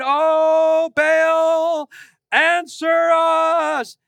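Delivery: loud, high desperate plea to Baal; desperate cry. Acted. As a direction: cry out to the idol, begging. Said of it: "the most desperate cries".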